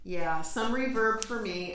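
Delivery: echoey reverb on the mic; talking into a trash can. Real, not acted. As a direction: voice booming in a tin can. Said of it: "It sounds like I'm in a trash can".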